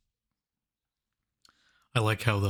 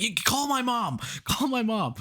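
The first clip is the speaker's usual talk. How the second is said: high-pitched urgent order; frantic mock plea; 13 semitones higher than usual. Crook to henchman while Dracula picks them off, frantic. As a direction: act out a desperate crook crying out.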